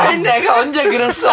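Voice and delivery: high, laughing put-on whine; Joo Jin-woo impression. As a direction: copy his denying whine, giggling. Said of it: "성대모사가 가능한".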